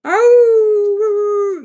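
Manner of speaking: high, loud drawn-out howl; werewolf howl. Retold or imitated. Showing a friend the sensor werewolf, loud imitated howl. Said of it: "it, it goes".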